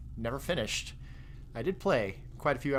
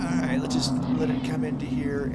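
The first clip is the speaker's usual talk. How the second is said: louder booming radio announcer; 3 semitones higher, 7 dB louder than usual. Shown as a demonstration. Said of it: "that radio announcer voice".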